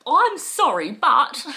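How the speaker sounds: loud, high, barely contained fury on "sorry"; raging sorry. Shown as a demonstration. Polite apology masking rage, loud and high. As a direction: say "I'm sorry, but" while exploding with rage. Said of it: "I'm actually exploding with rage".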